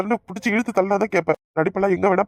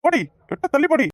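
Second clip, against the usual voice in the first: much higher furious order; angry shout; 8 semitones higher than usual. Acted. Husband drives his begging wife away, furious shout. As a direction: throw her out, fed up.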